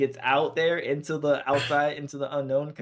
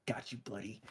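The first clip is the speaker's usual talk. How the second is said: soft reassurance; 4 semitones lower, 15 dB quieter than usual. Retold or imitated. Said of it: "he's like".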